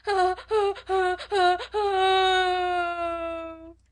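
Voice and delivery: high, loud repeated wails, last one held long; tempestuous child's howl. A narrator's reading. Small girl drowning out her teacher, howling. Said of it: "Lottie howled tempestuously".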